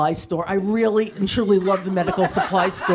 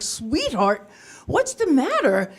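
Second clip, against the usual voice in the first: put-on teacher comforting child; 5 semitones higher, slower than usual. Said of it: "I was like".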